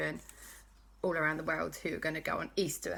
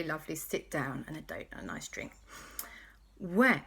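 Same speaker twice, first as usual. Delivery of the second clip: usual speaking voice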